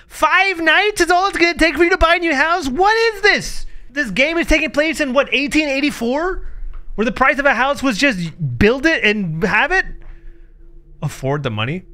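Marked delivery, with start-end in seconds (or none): 0.1-5.7 high, loud disbelieving outburst; incredulous mockery. Real, not acted. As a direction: scoff at the premise in shock. Mocking the game's premise.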